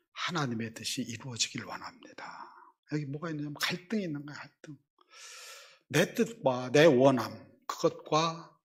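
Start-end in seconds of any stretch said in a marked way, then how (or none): 0.1-2.5 whispered prayer. Retold or imitated. Quoting Jesus' prayer.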